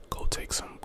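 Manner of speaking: normal talking voice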